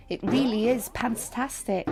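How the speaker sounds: ordinary delivery, nothing marked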